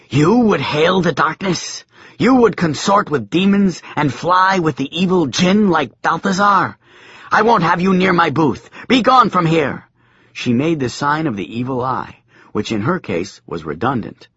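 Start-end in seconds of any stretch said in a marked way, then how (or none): 0.1-9.9 furious crone. A narrator's reading.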